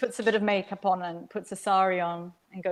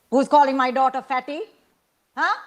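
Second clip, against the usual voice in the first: sharp, louder demand from the auntie; angry auntie; 4 semitones higher, 5 dB louder than usual. A narrator's reading. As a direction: confront the children, mildly angry. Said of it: "her eyes were narrowed with anger".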